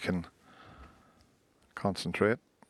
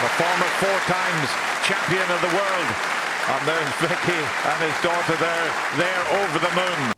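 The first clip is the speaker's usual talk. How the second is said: excited shout; 9 semitones higher, 9 dB louder than usual. Real, not acted. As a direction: bellow it out in triumph. Commentator erupting as the frame ends.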